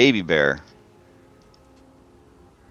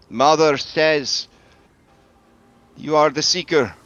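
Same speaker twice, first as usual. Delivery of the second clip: solemn Kavani woman; 6 semitones higher than usual. Acted. Kavani woman passes on her mother's message to the Seeker, solemn. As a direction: deliver the mother's message high, in measured phrases.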